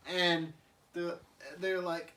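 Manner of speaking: usual speaking voice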